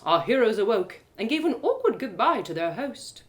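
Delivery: ordinary talk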